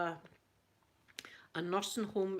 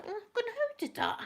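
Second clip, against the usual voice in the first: shocked; much slower than usual. Retold or imitated. Shocked at slammed door.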